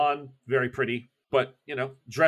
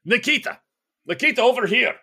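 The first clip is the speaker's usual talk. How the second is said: shouting a name across the room; calling out; 12 semitones higher, 7 dB louder than usual. Acted. Breslovich shouting across the party for Nikita. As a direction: call him over loudly, higher. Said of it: "let me get him".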